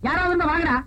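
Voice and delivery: loud call down the empty street for help. Acted. Drunk man shouting down the street for help, loud call. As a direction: shout for anyone nearby to come.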